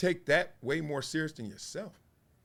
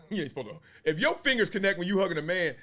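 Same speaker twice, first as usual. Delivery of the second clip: ordinary talk, nothing marked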